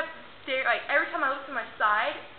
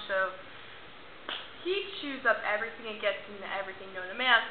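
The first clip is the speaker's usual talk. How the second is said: ordinary delivery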